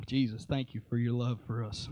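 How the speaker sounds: low, soft prayer; murmured thanks, dropped low; quiet prayer. Real, not acted. Preacher thanking Jesus before the congregation, low soft prayer.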